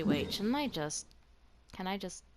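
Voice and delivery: usual speaking voice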